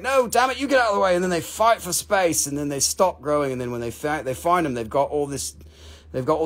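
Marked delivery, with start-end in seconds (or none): 0.0-1.1 calcite voiced. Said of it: "calcite's like".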